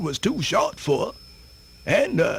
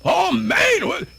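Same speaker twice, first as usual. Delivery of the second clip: excited exclamation; 6 semitones higher, 4 dB louder than usual. Acted. Storyteller hyping his tale.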